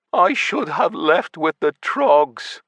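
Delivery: put-on slow, high sad old man's sigh; sighed sadly. A narrator's reading. Patrick regretting staying, slow and sad. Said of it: "Patrick sighed sadly".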